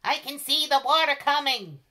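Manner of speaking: a shrieking lookout bird; squawking cockatoo. A narrator's reading. Cockatoo squawking about the flood to the animals. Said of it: "squawked the cockatoo".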